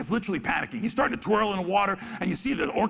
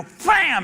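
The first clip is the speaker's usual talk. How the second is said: splash sound; 10 semitones higher, 8 dB louder than usual. Retold or imitated. Imitating whale splash.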